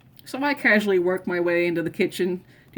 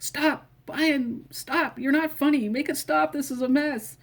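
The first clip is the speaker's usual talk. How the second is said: high, pleading mom in a panic; panicked mom; 7 semitones higher than usual. Retold or imitated. Mom panicking at the kids throwing chips, high and pleading. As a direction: beg them to stop like a frantic mother. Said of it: "My mom's having a heart attack".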